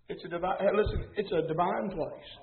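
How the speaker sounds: ordinary talk, nothing marked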